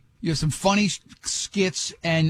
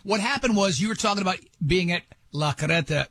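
ordinary talk, nothing marked

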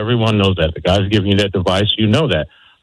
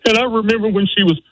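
choked-up whimper; 12 semitones higher than usual. Shown as a demonstration. Caller showing the father's tearful speech, whimpering. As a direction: voice cracking high as tears rise. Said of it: "if you find that whimper coming on".

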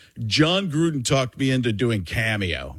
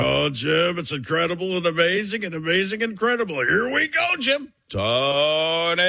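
excited, high rising Romo voice; Tony Romo impression; 5 semitones higher than usual. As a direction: build up higher into Here we go. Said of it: "So Romo would say something like".

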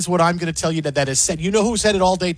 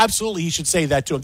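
normal talking voice

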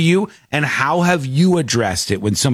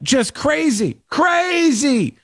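pitched-up disbelief; heated, high exclaim; 12 semitones higher, slower than usual. Real, not acted. Telling listeners about the lady, high outraged exclaim. Describing lady's outburst.

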